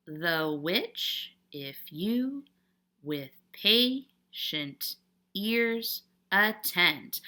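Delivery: slow, syllable-by-syllable iambic reading of "The which if you with patient ears attend"; "The which if you with patient ears attend" drawn out slowly, beat by beat; scanning the meter. Shown as a demonstration. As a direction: measure out "The which if you with patient ears attend" in unstressed-stressed pairs, pausing between them. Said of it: "Mark the rhythm pattern, both stressed and unstressed".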